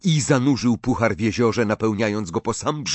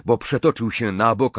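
ordinary delivery, nothing marked

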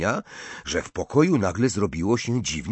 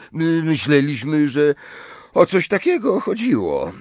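higher, louder, stammered recovery; flustered backtracking; 8 semitones higher, 6 dB louder than usual. A narrator's reading. Ron covers up after a warning look, flustered. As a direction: trip on the first word, then cover hastily in a raised voice. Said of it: "Ron błyskawicznie zmienił tok zdania".

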